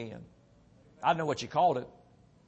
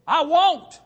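firm refusal; 10 semitones higher, 7 dB louder than usual. Retold or imitated. Refusing God in retold vision.

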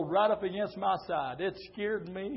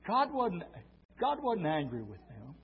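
ordinary delivery

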